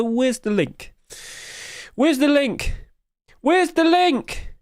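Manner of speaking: high-pitched wail, rising each time; mock panic. Streamer can't find the forum link, high mock panic. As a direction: squeal it in hammed-up distress.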